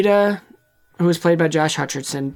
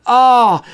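gasp; 7 semitones higher, 8 dB louder than usual. Retold or imitated. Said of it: "And then Katniss is like".

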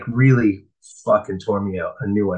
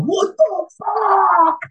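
drawn-out, high stunned cry; shocked disbelief; much slower than usual. Retold or imitated. To an old using buddy, stunned high cry. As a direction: recoil in total shock.

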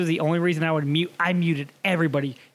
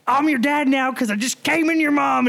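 put-on higher, bratty kid taunt; trash-talking kid; 6 semitones higher than usual. Kid on Xbox Live trash-talking, bratty taunt. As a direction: taunt like a cocky little kid. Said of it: "they'd be like".

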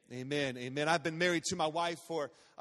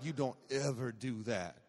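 low, quieter scolding mother; lowered, stern mock-mom warning; disapproving mom; 8 semitones lower, 4 dB quieter than usual. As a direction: imitate a mom laying down the law. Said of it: "Moms are like".

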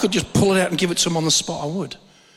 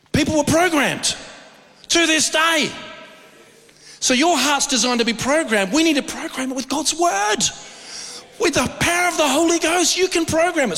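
preaching at full force; 10 semitones higher than usual. Acted. Preacher rousing the congregation about programming their hearts. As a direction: drive it home from the pulpit.

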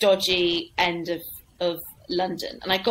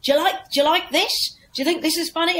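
fast, higher pleading; desperate comic; 7 semitones higher, faster than usual. Retold or imitated. Comic with a saucepan on his head pleads with the crowd, fast. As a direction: rush it like a flailing comedian. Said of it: "he was like".

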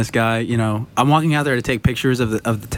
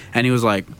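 ordinary delivery

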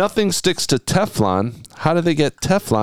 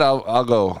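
ordinary talk

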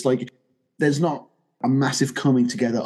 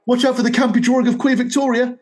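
much higher and louder Liverpool-ish quote; cartoon John quote; 11 semitones higher, 6 dB louder, faster than usual. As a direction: ham up the cartoon Beatle line. Quoting cartoon John again.

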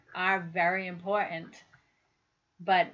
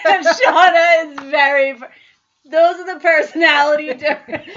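laughing loudly while talking, pitched up; real laughter, much louder and higher; 9 semitones higher, 18 dB louder than usual. Real, not acted. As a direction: giggle your way through the line. Laughing about their differences.

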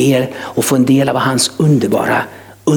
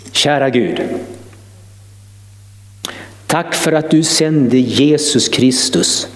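prayer. Acted. Leads the salvation prayer.

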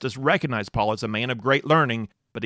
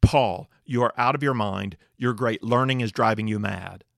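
usual speaking voice

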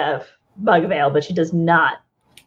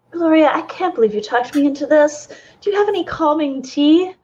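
nervous; 6 semitones higher, faster than usual. Acted. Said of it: "nervous to be back in the city".

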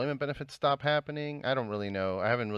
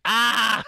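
loud, high "Ah!" of exasperation; frustrated scream; 11 semitones higher, 8 dB louder than usual. Retold or imitated. Acting out frustration at debate clip.

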